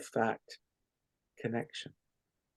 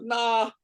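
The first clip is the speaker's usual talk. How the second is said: laughing release; 7 semitones higher than usual. Shown as a demonstration. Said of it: "with a laugh and a wave".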